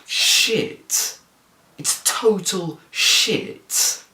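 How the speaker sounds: drawn-out, exasperated verdict on the film; disgusted rant. Real, not acted. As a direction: let the disgust drag out slowly. Ranting about the film's cinematography.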